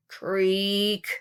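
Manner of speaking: ordinary delivery, nothing marked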